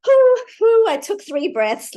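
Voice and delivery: high, loud, hammed-up huffing breaths; exaggerated self-satisfied whew; mock smug relief. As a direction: parody someone proud of three quick breaths. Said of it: "And if you're like".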